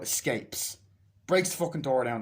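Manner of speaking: normal talking voice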